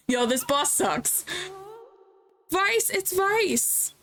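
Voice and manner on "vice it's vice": higher and slow recognition; character voice. Acted. Hero recognizing her friend Vice, higher and slow. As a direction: stretch the name out, higher.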